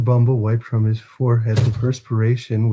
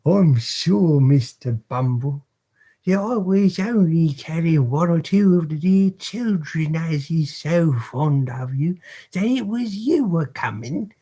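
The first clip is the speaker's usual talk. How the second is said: higher, simpering British woman's voice; bow and scrape to the beadle; humble matron; 6 semitones higher than usual. Acted. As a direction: Mrs Mann humbly answering Mr Bumble, high simpering British voice. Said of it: "replied Missus Mann with great humility".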